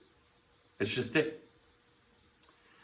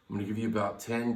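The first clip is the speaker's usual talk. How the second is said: ordinary talk, nothing marked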